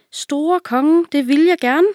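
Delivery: high, polite naive servant; sweetly refuse, like a simpleton; dim servant. A narrator's reading. Servant politely answers the king in the hole, high voice. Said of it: "sagde den dumme tjener".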